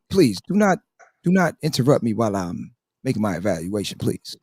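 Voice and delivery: low, measured persona laying down the rules; in character. Acted. Host asking the panel not to interrupt, in character. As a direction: drop low and deliver a soft, formal warning. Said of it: "That's how he talk".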